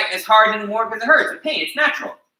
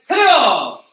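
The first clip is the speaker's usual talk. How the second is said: called command; 6 semitones higher, 5 dB louder than usual. Shown as a demonstration. Teacher calls the sparring command.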